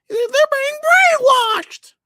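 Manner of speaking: squeaky high mocking voice; high put-on mocking squeal. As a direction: say it like a shrill caricature. Mocking high voice, clearly far.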